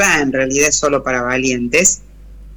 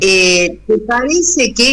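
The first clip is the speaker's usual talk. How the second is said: higher, slower mocking question; ironic question; 6 semitones higher, slower than usual. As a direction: needle him with a slow, sly question. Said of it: "es irónico".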